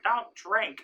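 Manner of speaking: high, loud imitation of a mother; mock stern warning. Mother warning son before the party, mock stern. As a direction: pitch it up as a sharp motherly order.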